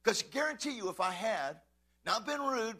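ordinary talk

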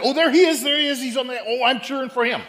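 loud, high fan cheering; excited cheering. Acted. Wife spotting her player on TV, loud happy cheering. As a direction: cheer him on like a new fan.

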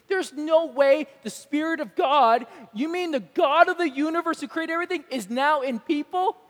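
mock the idea as absurd; incredulous scoff. Skeptic mocking God in people: loud incredulous scoff. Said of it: "I can imagine being like".

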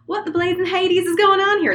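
put-on old Southern prospector yelling; Southern accent. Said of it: "this person is gonna be Southern".

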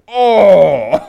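loud, high shocked "Oh!"; shocked gasp. Retold or imitated. Friends at the bar hearing his confession, loud shocked gasp. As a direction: gasp it out in disbelief.

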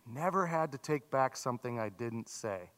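quiet, reflective repeat; soft echo. Shown as a demonstration. Pastor echoing the quote softly so the congregation thinks. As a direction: repeat it softly, letting it sink in. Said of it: "Think about that".

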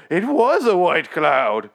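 loud, high, slow mock-British; theatrical British officer imitation; hammed-up British. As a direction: draw it out in an overblown British accent. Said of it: "That guy wasn't British enough for me".

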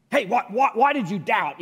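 loud, high, stammering accusation; acted angry scolding; condemning. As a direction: condemn him for doubting. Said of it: "what if he wasn't condemning him".

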